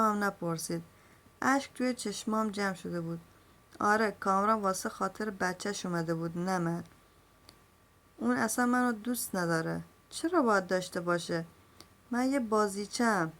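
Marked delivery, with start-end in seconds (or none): none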